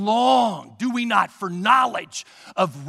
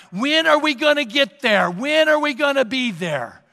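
put-on a light whiny kid voice, a bit louder; impatient kids; 5 semitones higher, 5 dB louder than usual. Kids nagging their mom on the way, mildly whiny. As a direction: kids asking are we there yet. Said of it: "your kids start asking".

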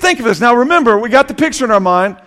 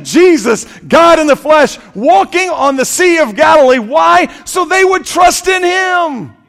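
preaching at full force; 6 semitones higher than usual. Real, not acted. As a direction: proclaim it to the whole congregation. Preacher building to climax.

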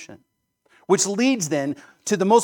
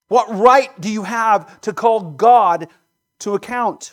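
louder, higher and slow, each word pressed; stern rebuke; 5 semitones higher, 9 dB louder, slower than usual. Acted. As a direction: demand an answer from the listener. Preacher's rising rebuke.

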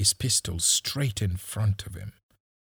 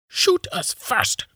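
loud, high, slow challenge; daring; 6 dB louder, slower than usual. A narrator's reading. Woman shielding boys dares policeman, loud high defiance. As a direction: dare them, punching each word. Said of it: "another woman dared the policeman".

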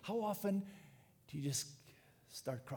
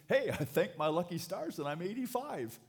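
a touch of cheeky relief, rising higher and louder; gleeful relief; 4 semitones higher, 4 dB louder than usual. Acted. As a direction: play someone lightly glad to be let off. Acts out a relieved eighty-five-year-old.

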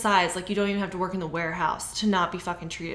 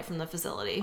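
dropped to a whisper; 3 semitones lower, 9 dB quieter, faster than usual. Real, not acted. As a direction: breathe the last words out. Trailing under breath.

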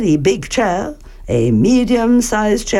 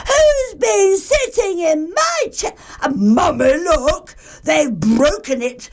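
high, squeaky little bear voice; crying Baby Bear; 9 semitones higher than usual. A narrator's reading. Baby Bear finds his chair broken, high tearful wail. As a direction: wail to Mummy like a tiny child. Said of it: "weeny chair and cried".

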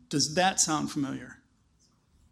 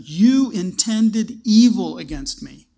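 slow, heavy and louder, every word weighed; stern accusation; 6 dB louder, slower than usual. Acted. Joseph facing his brothers, slow stern accusation. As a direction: lay the blame squarely on them.

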